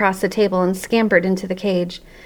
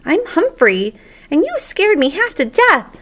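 high, squeaky little protest; squeaky hamster voice; 9 semitones higher than usual. Acted. Startled hamster telling the janitor off, squeakily. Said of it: "all that came out was squeak".

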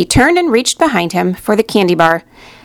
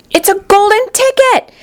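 high screaming shopkeeper, much higher; excited scream; 12 semitones higher than usual. A narrator's reading. Shopkeeper to the whole shop, screaming in excitement. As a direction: shriek it out in sheer excitement. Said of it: "screamed the shopkeeper".

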